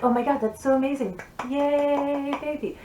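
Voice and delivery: high, gushing mock cheer for the baby; over-the-top praise. Mom and dad celebrating baby's first word, high excited praise. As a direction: cheer her on like she just won a prize. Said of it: "praising her over the top".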